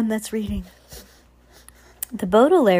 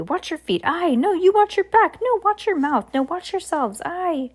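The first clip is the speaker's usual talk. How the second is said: high, lively sailor voice firing off jumbled orders; a cheerful, scatterbrained captain contradicting himself; scattered cheerful captain; 5 semitones higher than usual. Acted. Voices Widdershins-type captain giving orders.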